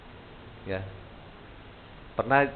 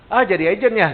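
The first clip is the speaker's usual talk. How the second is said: mock scoffing prospect, pitched up and louder; dismissive prospect; 8 semitones higher, 7 dB louder than usual. Prospect scoffs at the agent pitch, mock dismissive. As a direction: brush off the offer, half-mocking. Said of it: "Biasa kan gitu kan pasti ya".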